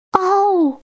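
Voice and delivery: a hurt tyrannosaur's roar; pained roar. A narrator's reading. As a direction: cry out like a wounded T. rex. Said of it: "霸王龙发出痛苦的呻吟声".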